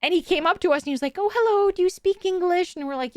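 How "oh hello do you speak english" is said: higher, imitated bashful man; overly shy stranger. Shy stranger approaching tourists to ask about English, imitated bashfully. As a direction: mimic a timid guy approaching strangers. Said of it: "very soft-spoken".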